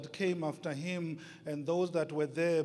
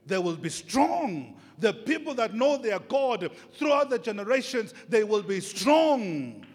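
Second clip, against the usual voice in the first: shouted preaching; 7 semitones higher, 10 dB louder than usual. Acted. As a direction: build to a shout of conviction.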